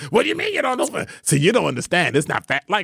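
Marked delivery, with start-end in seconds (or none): 0.1-1.1 high-pitched, teasing mock disbelief; mock incredulity. Co-host mimics showing Denise up over her ignorance, mock incredulous. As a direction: act scandalized, pitched way up, to show her up. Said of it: "make her look silly and say".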